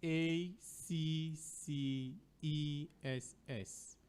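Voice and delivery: very slow letter-by-letter spelling. Shown as a demonstration. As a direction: draw out each letter for the listeners. Spelling out the second word.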